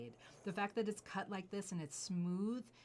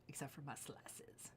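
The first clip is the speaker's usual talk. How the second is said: sly hushed afterthought; soft conspiratorial whisper; whispered aside; 3 semitones lower, 10 dB quieter than usual. Real, not acted. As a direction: whisper it as a secret.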